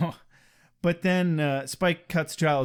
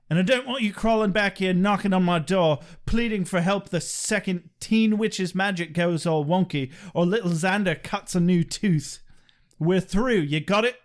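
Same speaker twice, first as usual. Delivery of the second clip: quick, insistent British vampire impression; Spike voice. Podcaster quoting Spike's line for fun, in the Spike voice. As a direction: rattle off an irritated British threat. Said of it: "I just like doing the Spike voice".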